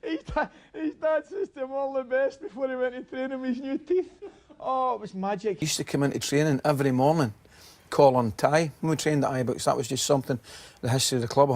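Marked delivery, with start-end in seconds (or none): 0.0-5.6 very high, squeaky with laughter; laughing while talking. Real, not acted. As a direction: repeat the punchline through helpless laughter. Helpless laughter retelling the punchline.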